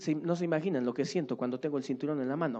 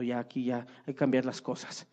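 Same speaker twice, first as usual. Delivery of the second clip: ordinary talk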